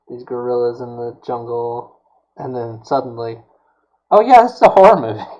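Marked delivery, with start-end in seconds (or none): 4.1-5.2 higher, louder, quick burst of mock surprise; mock realization. Host voicing a movie's sudden twist, mock realization. Voices the film's sudden genre turn.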